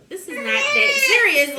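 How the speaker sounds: high, loud mock protest; incredulous protest. Retold protest as tables are set up.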